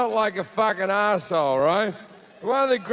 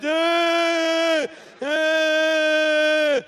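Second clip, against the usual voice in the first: high, louder hammed-up wail; mock crying wail; 8 semitones higher, 4 dB louder than usual. Comic imitates the crying girl with her hairdryer, high loud mock wail. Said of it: "she's crying, she's holding her hairdryer".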